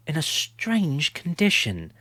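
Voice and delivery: ordinary delivery, nothing marked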